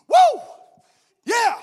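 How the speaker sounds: high, loud whoop and cheer; triumphant whoop. Acted. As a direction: whoop like you just finished a wild dash. Runner cheers after the dash.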